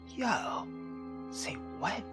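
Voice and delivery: ordinary talk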